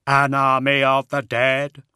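loud, slow robotic monotone, pitched high; hollow, mechanical warrior voice; hollow synthetic voice. Acted. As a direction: intone it like a machine through a speaker grille. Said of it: "said in his hollow synthetic voice".

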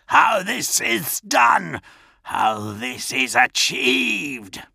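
slow, spitting villain hiss, rising high; seething, stretched-out demand; Kurz hissing. A narrator's reading. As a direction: spit out each word with drawn-out menace. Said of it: "Kurz hissed, spittle flecking between his blackened teeth".

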